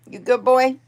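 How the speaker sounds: high cooing praise for the cat; pet talk. Real, not acted. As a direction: ask the cat sweetly, up high.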